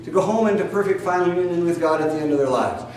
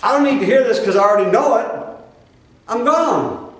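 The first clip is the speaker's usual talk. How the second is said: fast, louder huffy retort; dismissive brush-off; 6 semitones higher, 3 dB louder than usual. Retold or imitated. Preacher imitating a listener who dislikes the truth, huffy retort. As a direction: brush it off and storm out. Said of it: "you go".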